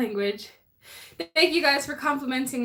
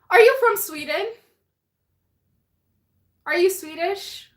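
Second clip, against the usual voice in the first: surprised; 7 semitones higher, 5 dB louder than usual. Real, not acted. Surprised question to a viewer.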